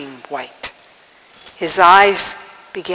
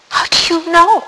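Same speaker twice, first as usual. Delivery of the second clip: high-pitched astonished question; astonished demand; 10 semitones higher, 3 dB louder, faster than usual. A narrator's reading. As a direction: ask it high, in sheer disbelief. Boy challenges the voice.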